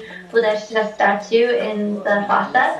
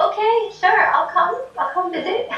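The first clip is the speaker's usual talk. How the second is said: higher, light amused acceptance; the Buddha agreeing; 8 semitones higher than usual. Retold or imitated. The Jo statue accepts Ben's feast invitation, higher and amused. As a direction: agree cheerfully, pitched up. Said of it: "Shakyamuni says".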